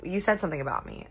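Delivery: normal talking voice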